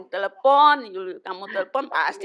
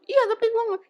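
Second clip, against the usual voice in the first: voicing the friend; much faster than usual. Friend voiced, retold.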